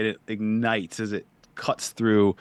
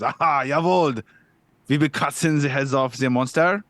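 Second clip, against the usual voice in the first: put-on hammy German accent, raised and loud; 5 semitones higher, 4 dB louder than usual. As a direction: bark it like a German sword spirit. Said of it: "he gets a vibe".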